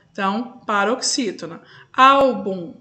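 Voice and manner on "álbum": "Álbum" with a loud, stressed "Ál"; teacher's demo of "Álbum", first syllable strongest. Shown as a demonstration. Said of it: "penúltima sílaba".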